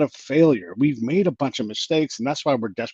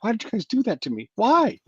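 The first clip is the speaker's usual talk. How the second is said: teary complaint; 9 semitones higher than usual. Retold or imitated. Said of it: "he was kinda teary-eyed".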